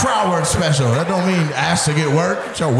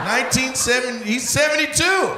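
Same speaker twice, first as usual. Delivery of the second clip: incredulous shout; 7 semitones higher than usual. Real, not acted. Shouting in disbelief at age.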